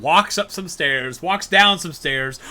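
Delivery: ordinary talk, nothing marked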